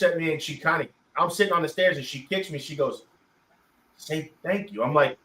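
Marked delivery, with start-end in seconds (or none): 4.0-4.8 softly imitated nudge from mom; mom's quiet prompt. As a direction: prompt a child under your breath. Mom prompts him after a kick.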